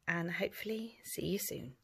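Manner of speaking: soft goodbye whisper. Real, not acted. Teacher whispering goodnight to children.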